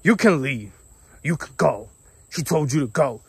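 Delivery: hint of a cranky old woman; bossy old lady. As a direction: imitate a bossy older coworker ordering someone out. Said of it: "she's like an old lady".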